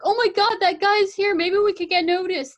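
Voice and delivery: high, loud, excited squeal; excited kids. Retold or imitated. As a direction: gush like starstruck teens. Excited kids spotting a photographer.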